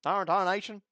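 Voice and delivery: a cartoon hillbilly cussing mildly. Shown as a demonstration. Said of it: "redneck hillbilly accents".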